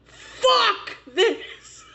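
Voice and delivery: high yelled mock outburst of rage; hammed-up angry yell; raging boyfriend. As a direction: yell it like a man losing it over ruined donuts. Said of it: "he was so fucking mad".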